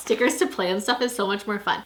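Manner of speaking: ordinary talk, nothing marked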